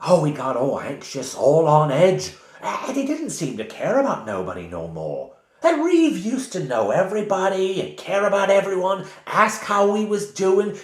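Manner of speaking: put-on high Cockney-style old woman; Christmas Carol laundress. Old woman telling the party about wolves, high Cockney laundress voice. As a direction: tell the tale like a Dickens laundress. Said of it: "sound like the laundress from Christmas Carol".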